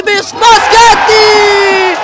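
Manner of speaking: shouted, high-pitched goal call; breathless italian goal commentary. Acted. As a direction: scream the equaliser, breathless.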